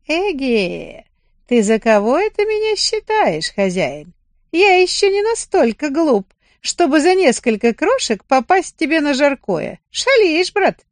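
a wise bird refusing the bait; sly rooster. Acted.

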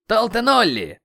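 loud, high call for a companion; shout. A narrator's reading. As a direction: shout the name sharply. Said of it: "крикнул мой владелец".

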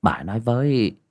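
ordinary delivery, nothing marked

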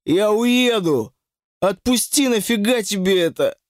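narrated groaning plea; moaning plea. A narrator's reading. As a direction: beg to be let go, moaning. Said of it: "стонал Вова".

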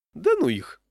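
high, loud waitress retort; waitress brushing it off. A narrator's reading. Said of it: "сказала официантка".

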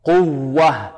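pressed double w in قوة; stressed doubled waw. Shown as a demonstration. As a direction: press into the و of قوة, quw-wah. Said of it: "الواو المشددة إذا كان قبلها ضم". (نبر الواو المشددة في قوة)

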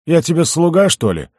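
high, loud, indignant retort; peeved protest; disgruntled grumble. A narrator's reading. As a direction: snap back grumpily at being ordered about. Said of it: "недовольно буркнул карлик".

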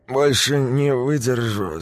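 put-on slurred drunken mumble; drunk slurring. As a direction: mumble it, tongue tied by drink. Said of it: "заплетающимся языком бормотал Сергей".

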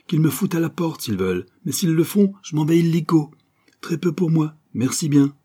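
high, emphatic bravado; defiant. A narrator's reading. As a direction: dare the bosses to fire you. Joey defiantly quits working. (bravade provocatrice, voix haute)

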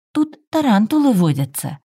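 put-on high earnest child warning; a worried little boy, deadly serious; serious and concerned. A narrator's reading. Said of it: "серьёзно и озабоченно предупреждал ангелок". (серьёзно и озабоченно, высоким голосом)

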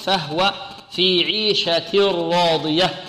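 "فهو في عيشة راضية" drawn out slowly; slow recitation. Shown as a demonstration. Teacher recites the whole phrase. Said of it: "فهو في الوقف".

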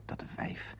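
a near-silent whispered curse under the breath; whispered muttering; muttered curse. Acted. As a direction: spit it out under your breath. Mutters at wife's interrupting call.

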